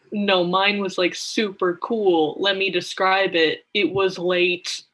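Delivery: loud, drawn-out self-important boast; mock storyteller with heavy, slow emphasis; Jughead impression. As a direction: imitate him bragging, then drag out the "uh". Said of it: "It's a really good Jughead impression".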